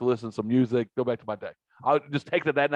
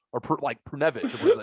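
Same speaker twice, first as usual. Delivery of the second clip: ordinary talk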